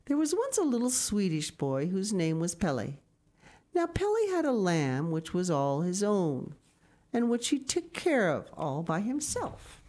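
bright, lifted read-aloud storytelling; storybook narration. A narrator's reading. Reading a picture book aloud to children, lifted storytelling. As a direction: tell it like a bedtime story to the kids.

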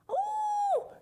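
very high startled cry; great surprise. A narrator's reading. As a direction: gasp it out in shock. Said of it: "she said with great surprise".

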